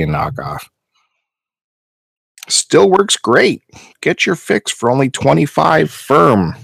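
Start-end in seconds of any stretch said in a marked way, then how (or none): 2.5-6.5 mock sales pitch. Reading out seller's ad mockingly.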